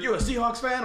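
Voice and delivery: high, loud mock American; exaggerated American accent. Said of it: "exaggerating the accent".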